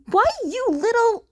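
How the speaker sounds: high, loud, fast angry sputter; outraged sputter. A narrator's reading. Mirko sputtering in outrage at Bakugo's insult. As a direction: sputter at the rude brat.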